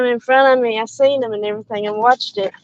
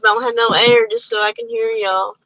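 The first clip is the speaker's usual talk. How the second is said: ordinary talk, nothing marked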